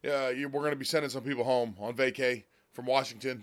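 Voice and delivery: ordinary talk, nothing marked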